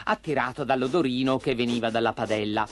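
usual speaking voice

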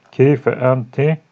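Keyfe enti stretched slowly; slow model reading. Shown as a demonstration. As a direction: spell out Keyfe enti for the class. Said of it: "teacher slowly models Arabic phrase".

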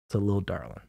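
usual speaking voice

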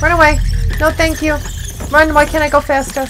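loud, high-pitched real panic; panicked. Real, not acted. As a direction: blurt it out loudly while running away. Fleeing in horror game.

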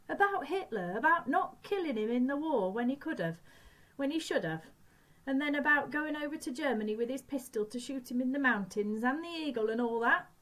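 put-on high, urgent boyish questioning; urgent pleading. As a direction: push it, desperate for confirmation. Boy urgently asking Ma.

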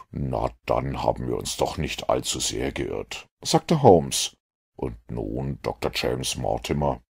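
a dry detective mildly regretting his deduction. A narrator's reading. Holmes to Mortimer, low and rueful.

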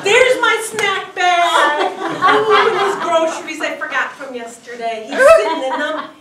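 loud, gleeful and laughing; excited. Retold or imitated. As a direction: crow over the stolen snacks. Raccoon's delight at groceries.

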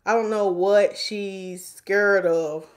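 normal talking voice

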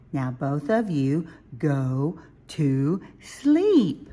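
slow, word-by-word motherly instruction; firm bedtime order. Acted. Mommy Owl tells both to sleep, slow and firm. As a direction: space out each word, leaning on the last.

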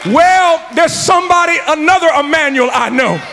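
loud, high, fervent sermon climax; rally the congregation with rising fervor; preaching at full force. Acted. Preacher urging the congregation to stand up for God, loud and fervent.

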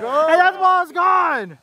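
high, drawn-out holler; excited call. Real, not acted. Commentator hollering as the ball flies away, excited call. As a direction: stretch out the big-hit call. Ball hit out during play.

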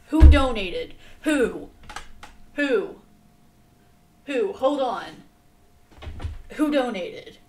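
loud, startled demands, over and over; surprised shouting. Real, not acted. Streamer to chat about a missed donation, shouting in surprise. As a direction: yell it out, baffled and indignant.

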